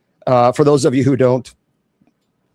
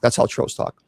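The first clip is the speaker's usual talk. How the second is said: whispered aside, much softer; 5 semitones lower, 5 dB quieter than usual. Real, not acted. Bishop whispering an aside about trolls.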